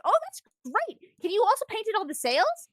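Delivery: high, excited acted request; eager and high, like an overjoyed captain; delighted exclamation. Luffy delighted by the new flag.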